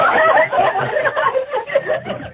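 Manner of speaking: high burst of laughter. Real, not acted. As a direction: laugh hard at your own joke. Laughter after joke.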